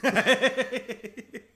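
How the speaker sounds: loud, high burst of laughter; big laugh. Real, not acted. Cracking up at their failed bit of acting, loud laugh.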